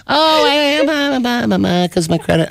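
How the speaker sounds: imitating wife. Voicing wife's excuse.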